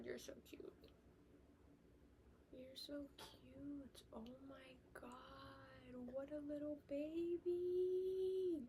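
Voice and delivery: soft, whispered cooing over a puppy. Real, not acted. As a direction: coo at the little dog, barely voiced, slowly. Cooing over her dog.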